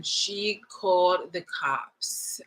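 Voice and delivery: very slow, drawn-out telling; slow reveal. Real, not acted. Telling viewers the woman called the cops, slow reveal. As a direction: stretch out each word for the reveal.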